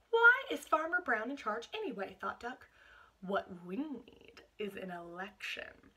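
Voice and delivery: picture-book narration for a class; storybook reading. Acted. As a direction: read it like a story for little listeners. Story read aloud to class.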